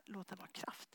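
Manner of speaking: soft unvoiced prayer voice; whispered prayer. Preacher recalls blessing the books, whispering. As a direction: murmur the blessing like a secret.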